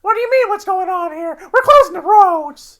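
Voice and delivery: very high, loud nasal trooper impression; hammed-up high-pitched roadblock cop. Imitating the trooper's undubbed lines for the co-host, high nasal. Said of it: "with the high nasal".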